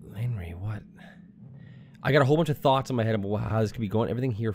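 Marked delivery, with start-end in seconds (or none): 0.1-0.8 whisper. Real, not acted. Unvoiced whisper heard.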